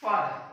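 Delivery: फादर said "faa-dhuh", no R; teacher modeling फादर; model pronunciation. Shown as a demonstration. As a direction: say फादर without the R. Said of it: "इसे ऐसे बोलते हैं".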